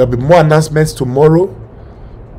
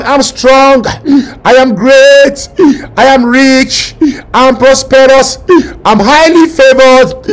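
higher, louder confessions; shouted declarations; 6 semitones higher, 4 dB louder than usual. Acted. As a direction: shout each confession with faith. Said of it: "Keep shouting".

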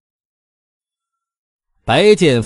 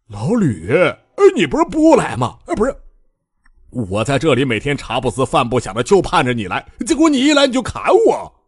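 aggrieved whining; 5 semitones higher than usual. A narrator's reading. Bai Jianfei whining to old Lü, high and aggrieved. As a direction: whine like a wronged friend. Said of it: "白剑飞委屈的嚷嚷".

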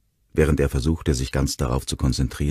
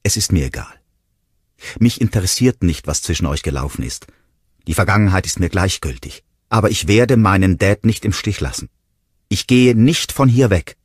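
defiant, raised voice; louder and higher as the defiance builds; calling out; 5 semitones higher, 5 dB louder than usual. A narrator's reading. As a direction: stand your ground, stressing refusals forcefully. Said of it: "rief sie".

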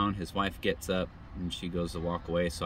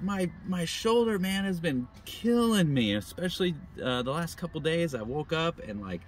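complaining stranger; 8 semitones higher than usual. Retold or imitated. Stranger complains of shoulder pain.

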